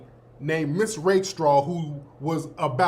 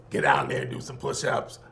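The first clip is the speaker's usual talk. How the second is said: electrolarynx coach; 3 dB quieter than usual. Retold or imitated. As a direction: coach barking orders through a throat voice box, very low and buzzy. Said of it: "he talked like this".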